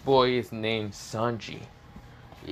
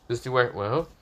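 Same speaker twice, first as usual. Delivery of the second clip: ordinary delivery, nothing marked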